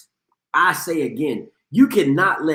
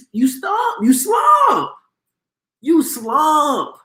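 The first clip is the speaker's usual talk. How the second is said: excited yell; 9 semitones higher, much slower than usual. Real, not acted. Host hyping Quincy's arm, yelling you slump. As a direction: shout you slump up high with glee.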